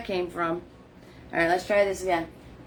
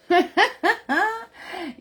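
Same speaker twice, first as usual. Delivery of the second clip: a bright high burst of laughter; amused laugh; 10 semitones higher than usual. Real, not acted. Host laughing at her own laid-back remark, light laugh.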